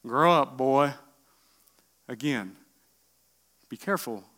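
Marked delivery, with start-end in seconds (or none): 0.0-0.9 dad's scolding. Retold or imitated. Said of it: "if my dad was alive".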